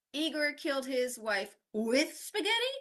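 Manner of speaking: WITH punched, high rise to the end; stress on with. Shown as a demonstration. Teacher showing how stress changes meaning, with stressed. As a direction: question the preposition, stressing "with". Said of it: "changing the stress in each".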